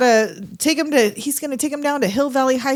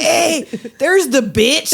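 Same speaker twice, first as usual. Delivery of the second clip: slow, higher vato call through laughter; imitated laughing vato; 4 semitones higher, 3 dB louder, much slower than usual. Podcaster imitating the actor's outtake, laughing vato call. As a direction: draw it out as a giggling vato. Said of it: "laughing through the whole thing".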